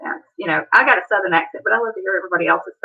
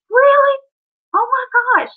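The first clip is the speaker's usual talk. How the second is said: high, loud gasp of delighted surprise; amazed disbelief; 14 semitones higher, 4 dB louder than usual. Retold or imitated. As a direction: squeal it like you can't believe your ears. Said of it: "And I'm like".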